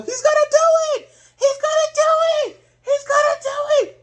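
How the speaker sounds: very high, loud child impression; excited kid from a commercial. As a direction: squeal it like a cheering kid. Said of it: "When that kid, I think it was a kid".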